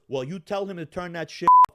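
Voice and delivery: loud, barked command; angry order. Retold or imitated. Man in the bar ordering the owner about the music, angry and loud. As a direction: demand it be shut off now.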